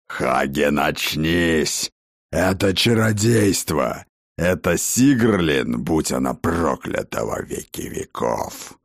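low, grave, urgent warrior voice; a grim war chief calling his pupil back; stern old warlord. Acted. Shaking Hagen, cursing the sorceress.

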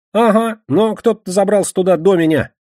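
high-pitched, insistent excuse; defensive Кегля. Acted. Кегля reports on searching their lodging, defensive.

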